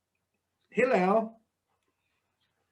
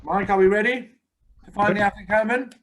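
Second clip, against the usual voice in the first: calling out; 4 semitones higher, 4 dB louder than usual. Real, not acted. Calling out to absent helper.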